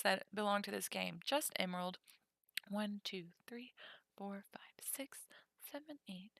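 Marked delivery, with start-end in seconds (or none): 2.7-6.3 soft unvoiced count; whispered counting. Real, not acted. Streamer counting cards, whispered under breath.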